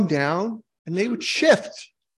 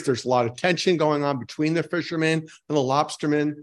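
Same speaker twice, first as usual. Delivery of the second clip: ordinary talk, nothing marked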